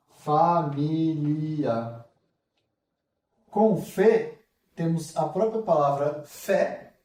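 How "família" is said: Família stretched out slowly and clearly; slow model word. Shown as a demonstration. Teacher models the whole word slowly after the syllables. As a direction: say Família slowly for the class to copy. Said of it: "slow model word".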